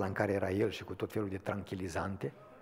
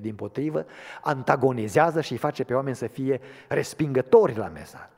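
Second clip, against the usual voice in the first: much higher and louder, pleading sermon voice; impassioned preaching; 8 semitones higher, 16 dB louder than usual. Acted. Preacher asking the church what its message is, impassioned preaching. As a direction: call out to every hurting soul.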